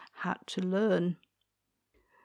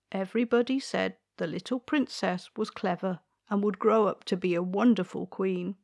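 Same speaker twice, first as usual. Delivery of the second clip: usual speaking voice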